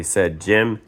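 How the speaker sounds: plain reading voice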